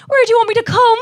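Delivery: high, loud sing-song Cork accent. Shown as a demonstration. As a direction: call it out in a broad Cork accent. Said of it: "say that in a Cork accent".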